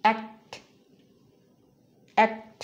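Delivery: "অ্যাক্ট" with the k held, no puff; unaspirated cluster. Shown as a demonstration. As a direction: close the k straight into a light t in "অ্যাক্ট". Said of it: "এরকম উচ্চারণ হবে".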